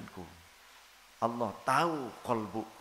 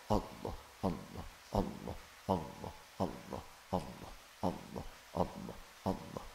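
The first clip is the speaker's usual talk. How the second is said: much softer and lower, murmured Allah Allah; hushed zikir; 8 semitones lower, 7 dB quieter than usual. Shown as a demonstration. Preacher demonstrating silent heart zikir to congregation, hushed murmur. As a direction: let the name fall inward, barely voiced. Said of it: "zikir khafi yang tidak diucapkan".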